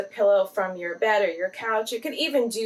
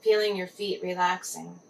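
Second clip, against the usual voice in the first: guided meditation. Acted. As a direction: gently guide the listener into relaxed body awareness.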